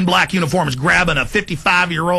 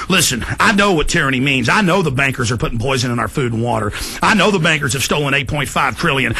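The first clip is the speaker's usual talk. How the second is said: ordinary delivery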